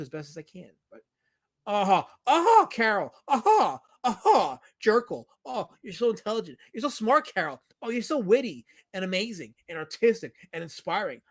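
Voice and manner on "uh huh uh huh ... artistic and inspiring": loud, high mocking fake laugh into gushing praise; sarcastic mock praise. Streamer mocking a viewer's witty name joke, sarcastic gushing. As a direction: gush sarcastically, pitched up.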